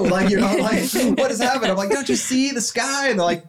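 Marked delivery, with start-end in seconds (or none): 1.2-1.7 high, hammed-up what is happening; mock bafflement. Acting a baffled reaction. 1.9-3.1 high, slow, dreamy marvel; mock wonder. His awed voice in the story.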